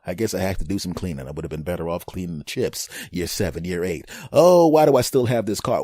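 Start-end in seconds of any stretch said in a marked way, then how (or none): 4.3-5.8 louder, exasperated complaint; exasperated spouse. Retold or imitated. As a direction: demand an answer, annoyed. Spouse confronting about old car.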